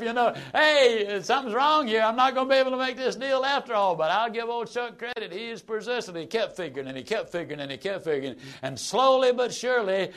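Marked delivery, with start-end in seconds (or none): 0.5-4.0 louder, higher, hurried panic in his head; alarmed inner thought. Retold or imitated. Buyer panicking silently at the dealer's frown, raised alarmed voice. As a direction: think it in a sudden rush of alarm. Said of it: "I kept thinking to myself".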